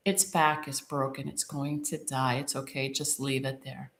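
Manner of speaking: low, soft and subdued retold man; softly voiced helpful stranger; quiet, resigned reassurance. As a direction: drop low and soft, gently breaking bad news. Man consoles her beside hurt cat.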